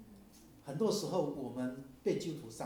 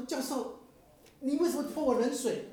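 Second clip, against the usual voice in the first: indignant student; 11 semitones higher, 7 dB louder than usual. Retold or imitated. Angry student confronts professor.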